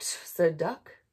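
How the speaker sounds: ordinary delivery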